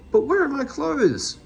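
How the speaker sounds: high, loud bewildered cry; a lightly voiced distraught Meddle; dismayed wail. A narrator's reading. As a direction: plead in panic. Meddle distressed, clothes gone.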